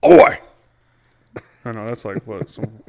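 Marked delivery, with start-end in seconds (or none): 0.0-0.4 loud, high Aussie "Oi!"; mock Aussie shout. Joking about Australia, loud high mock Aussie shout. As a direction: bark out a cheeky Aussie Oi.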